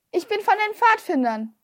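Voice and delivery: high-pitched announcement; calling up. A narrator's reading. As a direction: pitch it up to reach the window. Child still calling up to window.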